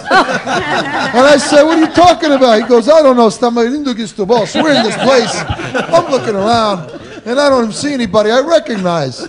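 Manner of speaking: high, loud, words bubbling with laughter; can barely get it out for laughing; laughing through it. Real, not acted. Son retelling the wrong-day wedding, laughing hard.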